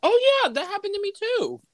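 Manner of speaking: jokey falsetto with a laugh; squeaky high voice. Pretend. Joking reply in squeaky voice.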